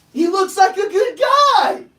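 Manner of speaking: high, loud, slow burst of delight; light up like you just saw the change; excited exclamation. Real, not acted. Coach marveling at the smiling owner, excited exclamation.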